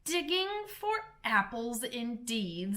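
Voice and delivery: slow, scornful repeat with a touch of anger; mild anger. Acted. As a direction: sneer at the excuse, understated. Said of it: "said the rabbit angrily".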